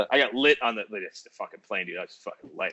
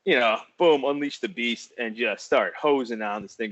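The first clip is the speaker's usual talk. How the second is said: normal talking voice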